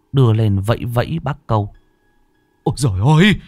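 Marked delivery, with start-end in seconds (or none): 2.6-3.4 high, frightened cry of alarm; terrified shriek. A narrator's reading. Man seeing the dead woman wave at him, shrieking in terror. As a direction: cry out in sudden horror. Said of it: "Bác Câu rít lên một tiếng".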